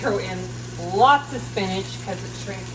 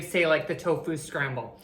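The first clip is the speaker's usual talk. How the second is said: normal talking voice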